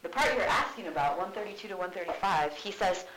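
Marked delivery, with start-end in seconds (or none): none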